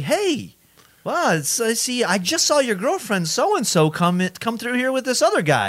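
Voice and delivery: high, chirpy oblivious gossip voice; imitating Pat. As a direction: tell the news brightly, unaware it stings. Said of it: "he was like".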